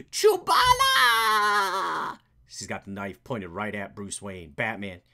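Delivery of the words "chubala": very slow, loud shouted cry; war cry. Acted. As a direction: bellow it as a battle yell.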